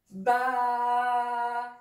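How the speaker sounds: ba held long, light; "ba" doux et allongé; soft long ba. Shown as a demonstration. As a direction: say ba soft and stretched. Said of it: "c'est des lettres doux".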